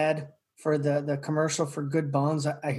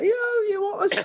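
falsetto country accent, showing off; very high, hammed-up country accent; put-on accent. Shown as a demonstration. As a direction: squeal it in a high put-on twang. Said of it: "what other accent do you need".

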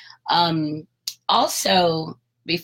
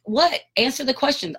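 demanding answer; 9 semitones higher than usual. Retold or imitated. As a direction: press him hard for an answer.

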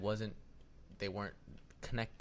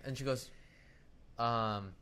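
ordinary talk

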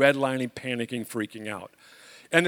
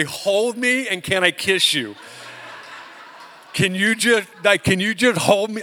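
higher, louder gushing plea with a laugh; overjoyed plea; 6 semitones higher, 6 dB louder than usual. Retold or imitated. Homeowner gushing at his rescuer, loud and laughing. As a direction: beg to be held, comically overwhelmed. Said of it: "I wanted to say".

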